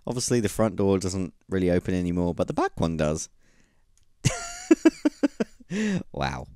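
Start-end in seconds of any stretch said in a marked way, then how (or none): 4.2-5.4 squeaky high-pitched giggle. Real, not acted. Laughing at result.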